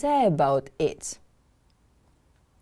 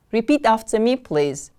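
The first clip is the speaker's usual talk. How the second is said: ordinary talk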